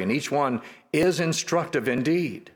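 ordinary delivery

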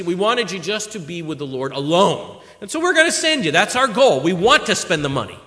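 rising pulpit fervor; fervent preaching. Acted. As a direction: preach it out to the congregation. Preaching at full force.

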